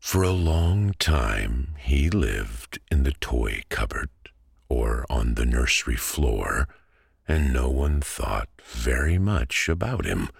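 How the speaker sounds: ordinary delivery, nothing marked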